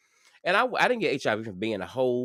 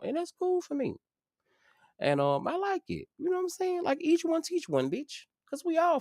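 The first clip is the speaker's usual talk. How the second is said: playful voice pitched way up; high squeaky voice. Acted. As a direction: squeak it out, teasing.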